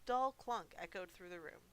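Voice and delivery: usual speaking voice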